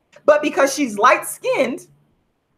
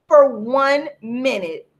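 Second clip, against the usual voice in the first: usual speaking voice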